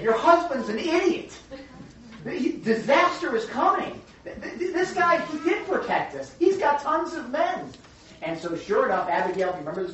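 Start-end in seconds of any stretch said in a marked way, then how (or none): 0.0-7.7 high, urgent stammering warning; panicked servant. Retold or imitated. Servant warning Abigail that disaster is coming, high and panicked. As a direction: blurt it out in panic, stuttering. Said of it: "the servant said".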